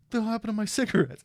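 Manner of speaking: friend Ogre. Retold or imitated. Teller mimicking his friend Ogre's outrage, high and quick. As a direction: squeal it like a put-out buddy. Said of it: "He was like".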